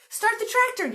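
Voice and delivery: high, loud frantic command; urgent yell. A narrator's reading. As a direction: yell it while under attack. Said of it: "yelled Minnie".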